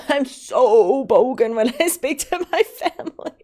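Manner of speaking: high, loud, laughing exclamation; laughing admission. Real, not acted. Streamer admits how she speaks with family, laughing. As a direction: blurt it out through laughter.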